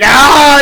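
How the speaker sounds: loud high enraged roar; angry mock roar. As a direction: let out a furious yell. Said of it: "Cody's like".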